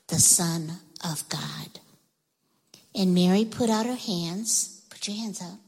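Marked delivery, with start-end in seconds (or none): 0.1-1.8 whispered, like a wonder too big to say aloud; awed whisper. Acted. Storyteller whispering the angel's promise to the children. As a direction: breathe it out as a hushed secret.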